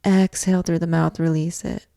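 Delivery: ordinary delivery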